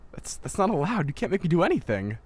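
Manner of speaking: high, loud indignant refusal; indignant protest. Acted. Student refusing her mentor's order, indignant and loud.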